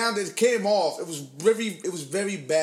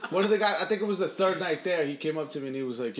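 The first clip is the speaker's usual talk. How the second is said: ordinary talk, nothing marked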